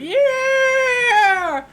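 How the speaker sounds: very high, very loud cheering; drunken celebration shout. Acted. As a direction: yell it like a drunk crowd celebrating. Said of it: "people begin to shout and celebrate".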